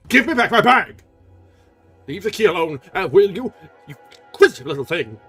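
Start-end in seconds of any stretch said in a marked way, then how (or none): 0.1-1.0 much higher, louder shout; shouted demand. Acted. Erik losing patience with Christine, shouting. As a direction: explode on the repeat.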